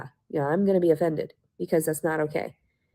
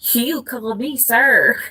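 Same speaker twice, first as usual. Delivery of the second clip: much higher and slow, a put-on voice; mock officer voice; 5 semitones higher, 7 dB louder, much slower than usual. Mocking the officers with a silly slow voice. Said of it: "certain officers I've dealt with".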